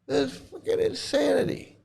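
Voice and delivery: high, strained disbelief; incredulous outrage; outraged. Real, not acted. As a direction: exclaim in exasperation. Outraged rant.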